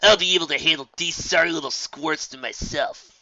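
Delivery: loud smug colt voice; arrogant. Acted. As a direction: sneer down at the newcomers. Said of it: "Scuffle grinned arrogantly".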